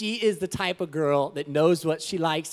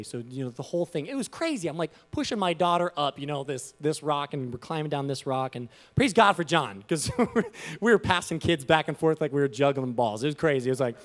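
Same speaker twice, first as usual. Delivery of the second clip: ordinary talk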